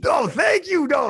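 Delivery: high, loud happy thanks; pleased and loud; delighted thanks. Real, not acted. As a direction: burst out thanking a friend. Reacting to a compliment.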